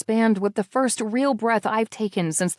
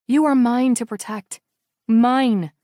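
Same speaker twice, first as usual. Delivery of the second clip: firm and louder, leaning on MINE; fierce vow; 3 semitones higher, 5 dB louder than usual. A narrator's reading. Narrator's heroine vowing to protect Misha, firm and loud. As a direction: vow protection to the boy, firmly.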